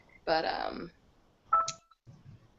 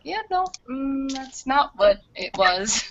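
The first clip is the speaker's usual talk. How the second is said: slow, pointed reply; 6 semitones higher, 4 dB louder, much slower than usual. Retold or imitated. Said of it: "And I was like".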